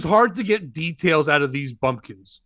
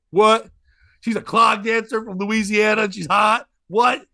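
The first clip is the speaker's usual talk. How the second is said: loud, disbelieving outburst; astonished comic retort; incredulous; 2 semitones higher, 5 dB louder than usual. Retold or imitated. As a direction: repeat it back in loud disbelief.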